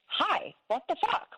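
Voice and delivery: high-pitched exasperated retelling; irritated. Retold or imitated. Wife nudging her ignoring husband's shoulder, irritated. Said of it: "I'm getting a little irritated".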